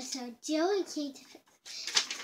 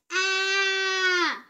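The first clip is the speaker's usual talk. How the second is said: falling cry; 5 semitones higher, 7 dB louder than usual. Acted. This is Jack falling.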